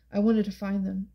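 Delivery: ordinary delivery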